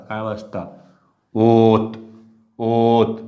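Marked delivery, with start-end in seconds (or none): 1.3-3.2 ओत with the ओ stretched long and the त cut short; drawn o. Shown as a demonstration. Teacher to class, showing ओत with a long ओ and cut त. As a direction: hold ओ in ओत, drop the vowel on त. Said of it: "ओ सुद्धा लांबायला लागलं".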